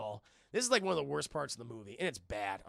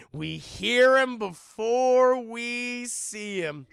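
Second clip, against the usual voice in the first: loud, slow and higher teasing reveal; dramatic build-up; 7 semitones higher, 11 dB louder, much slower than usual. Real, not acted. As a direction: stretch it out for suspense.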